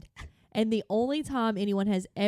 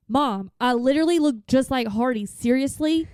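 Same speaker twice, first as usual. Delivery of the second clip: louder, put-out retold protest; imitated exasperated kid; 3 semitones higher, 7 dB louder than usual. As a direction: act out an offended kid insisting to Mom. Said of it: "she got mad at me".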